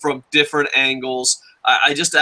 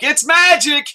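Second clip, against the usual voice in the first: high, loud taunting bully voice; mock bully; 12 semitones higher, 4 dB louder than usual. Bully taunting a punched kid, high mocking yell. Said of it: "a bully ... be like".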